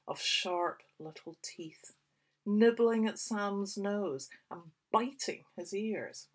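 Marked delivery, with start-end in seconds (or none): none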